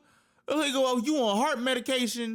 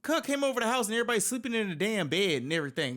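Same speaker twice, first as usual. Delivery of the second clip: usual speaking voice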